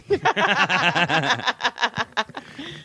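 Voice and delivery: long, high-pitched laugh. Real, not acted. Laughing at own joke.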